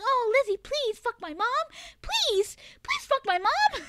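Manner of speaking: high-pitched mocking begging voice; Corvus. Streamer mimicking a chatter begging her, high teasing impression. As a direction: plead in a put-on high voice, close to laughing. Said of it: "that's what you sound like in my head".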